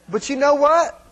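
usual speaking voice

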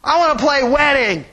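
mildly voiced girl protesting; a hint of a whiny little girl; little girl yelling. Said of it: "some little girl yells".

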